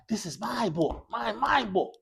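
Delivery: my repeated and punched; stress on my. Shown as a demonstration. Teacher demonstrating emphasis on my to students. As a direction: lean hard on my.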